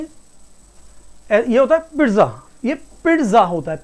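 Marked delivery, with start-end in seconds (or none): 1.5-3.8 पिज्जा as "pit-za" with an audible t; correct pronunciation. Shown as a demonstration. Teacher demonstrating the t sound, correctly pronounced. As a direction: put a t before the z in पिज्जा. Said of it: "इसमें ना ट का sound आता है".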